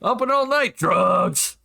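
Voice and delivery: high, drawn-out joke advert; mock sales pitch. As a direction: hawk it playfully, stretching the last words. Joking mock jingle for drug delivery.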